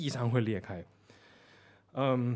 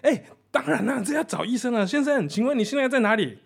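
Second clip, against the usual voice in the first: higher, louder anxious operator, half laughing; urgent; 9 semitones higher, 5 dB louder than usual. Retold or imitated. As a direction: rush it like a worried clerk. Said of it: "那边就更急了".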